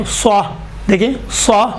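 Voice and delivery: سوا with thick emphatic s; rounded lips, full "swaa" in سوا; full-mouthed سوا; heavy saad. Shown as a demonstration. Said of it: "یہ سوات ہے".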